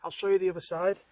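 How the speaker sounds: ordinary talk, nothing marked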